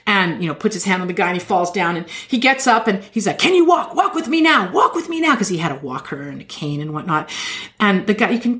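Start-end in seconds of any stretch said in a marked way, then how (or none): 3.4-5.4 high, hammed-up faith-healer preaching; televangelist healing. Preacher casting the devil out of a woman's ears, hammed-up and high. Healer urges man to walk.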